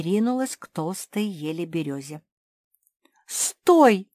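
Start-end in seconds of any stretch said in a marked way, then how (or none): 3.3-4.1 high, loud cry to stop; alarmed shout. A narrator's reading. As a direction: shout to halt someone. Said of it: "хором закричали старшие сёстры". (громкий окрик)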